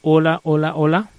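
very loud, level mic check; prueba de micrófono. Real, not acted. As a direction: test the mic loudly, rising at the end. Probando el micrófono. (prueba de sonido en voz muy alta)

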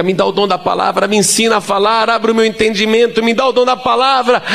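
rising petition to God; fervent prayer. Retold or imitated. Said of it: "comecei a orar".

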